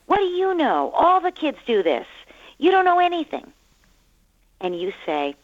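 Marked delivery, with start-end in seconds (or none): 0.1-3.5 put-on high, loud defiant kid voice; protesting child. Child protesting to the parent about screen time, high and defiant. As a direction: play a kid talking back to a parent. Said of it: "your child might protest".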